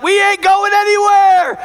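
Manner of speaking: high, slow, loud stubborn shout; tired legs shouting they quit; legs refusing. Acted. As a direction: dig in and refuse to budge.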